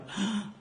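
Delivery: quick mock gasp of shock; a light startled intake of breath; retold gasp. Billy hearing the confession, a mock gasp.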